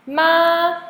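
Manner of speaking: high, level, loud "Ma"; first tone. Shown as a demonstration. Teacher running through four tones on one sound, "Ma" high and level. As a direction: hold "Ma" high and flat. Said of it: "Now, M A".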